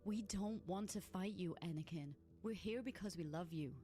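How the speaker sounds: high, soft and pleading; tender. Acted. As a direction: reach out gently with love. Ahsoka pleads with love.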